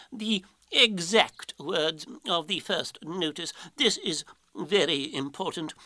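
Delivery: slow, weighty owl pronouncement; drawn-out, emphatic storybook Owl; ponderous Owl, insistent. Acted. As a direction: spell out the importance as a pompous owl.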